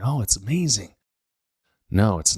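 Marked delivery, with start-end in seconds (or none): 0.0-0.9 sarcastic gushing, pitched higher; mock amazement. Mocking product ads, sarcastic gushing. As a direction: parody the ad's fake delight.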